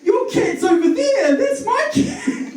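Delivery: very high shout ending in a laugh; mock lady shouting. Cat owner to the driver, very high mock shout. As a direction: shriek it at the man, frantic. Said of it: "she goes".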